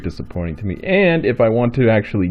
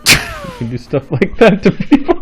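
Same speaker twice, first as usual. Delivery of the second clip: laughing; 5 dB louder than usual. Real, not acted. Teasing over a kill, laughing.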